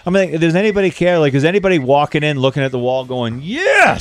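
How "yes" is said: mock excited shopper. Imitating shopper staring at wall.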